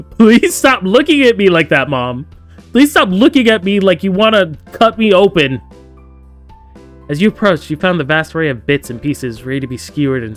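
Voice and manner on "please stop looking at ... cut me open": high, loud mock-freaked plea; loud, pitched-up mock begging; hammed-up pleading. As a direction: beg her to stop, playfully panicked. Pleading with creepy game mom.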